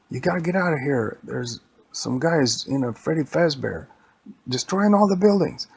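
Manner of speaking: high, loud acted alarm; urgent police warning. Police warning bystanders of a rampaging robot, loud urgent voice. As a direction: warn them urgently to clear out.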